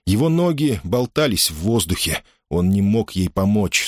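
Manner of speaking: ordinary delivery, nothing marked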